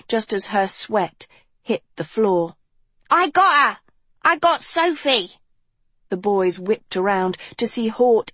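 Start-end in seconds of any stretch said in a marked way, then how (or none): 3.1-5.3 high, loud triumphant shouting; excited shout. Acted. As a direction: yell it in triumph.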